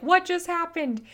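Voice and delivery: a lightly voiced little boy, bewildered; imagined shock. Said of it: "he was probably shocked".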